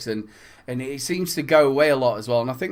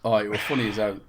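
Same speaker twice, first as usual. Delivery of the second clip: ordinary talk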